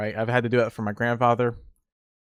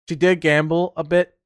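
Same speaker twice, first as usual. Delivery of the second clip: normal talking voice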